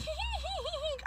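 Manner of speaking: high, breathy imitated owl hoots. Teller imitating the injured owl, soft high hoots. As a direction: mimic the owl's faint high hoots. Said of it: "the owl was like".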